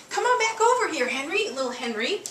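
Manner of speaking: high coaxing pet voice; calling the dog. Real, not acted. Owner calling little Henry over in a high sweet voice. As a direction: sing his name up high to lure him over.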